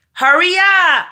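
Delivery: high, loud hurry-up call. Real, not acted. Mother shouting at her kids to hurry, loud and high. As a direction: shout it through the house to hurry them.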